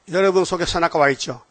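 ordinary talk

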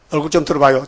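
ordinary talk

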